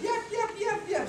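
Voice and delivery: high-pitched happy little yaps; imitated dog yapping. Happy dog with the daughter, excited yapping. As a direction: yap high like a delighted lapdog.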